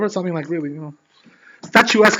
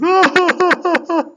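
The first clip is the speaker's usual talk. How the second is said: high-pitched mock bawling; imitated crying; 4 dB louder, slower than usual. Storyteller shows the rabbi suddenly crying, mock sobbing. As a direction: fake a sudden wailing cry in bursts. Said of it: "he cries, you know".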